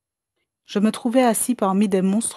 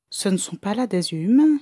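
usual speaking voice

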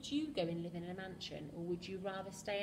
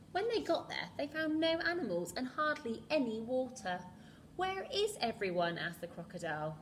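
put-on lively read-aloud to children; storybook reading. A narrator's reading. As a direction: read it like a bedtime picture book. Reads picture book to children.

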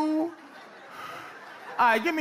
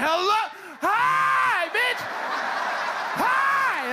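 mock yelling hello; 10 semitones higher, much slower than usual. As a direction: yell it like all caps. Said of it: "You think I said".

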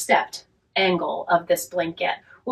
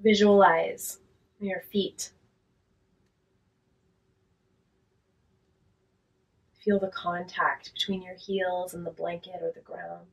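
guided relaxation; 5 dB quieter than usual. Real, not acted. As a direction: talk them gently down into rest, unhurried and quiet.

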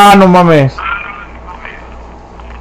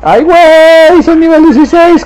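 very high, drawn-out squeal of joy; squealing excitement; 13 semitones higher than usual. Pretend. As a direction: shriek it like a thrilled kid. Mock joy at reaching level sixteen.

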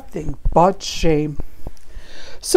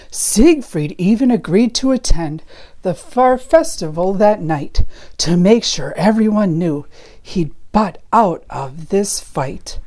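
usual speaking voice

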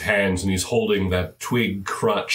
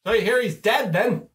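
much higher, squeaky little gnome gossip; 12 semitones higher than usual. Acted. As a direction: pitch way up into a chirpy gnome. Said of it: "the gnome looks up and says".

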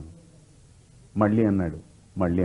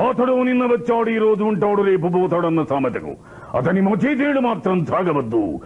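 high, declaiming heroic speech; Kattabomman dialogue; 8 semitones higher than usual. Shown as a demonstration. Mimic doing the dubbed Kattabomman speech, high declaiming. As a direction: proclaim it like a defiant king. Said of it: "కట్టబ్రహ్మంలో dubbing".

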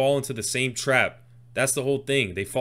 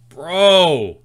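loud, higher drawn-out exclamation of amazement; blown away; 5 semitones higher, 10 dB louder than usual. Real, not acted. Reactor floored by the rapper's wordplay, loudly amazed. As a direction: let it burst out loud in disbelief.

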